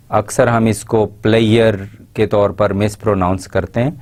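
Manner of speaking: ordinary talk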